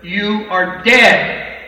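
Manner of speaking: slow, heavy "you are dead"; slow emphasis. Real, not acted. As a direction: drag each word out.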